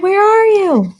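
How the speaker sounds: high, calling shout. A narrator's reading. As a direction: call out across the zoo. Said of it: "we shouted".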